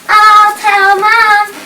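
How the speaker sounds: slow, high sung taunt; mocking singsong. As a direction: mimic him in a teasing singsong. Sibling mocks the threat.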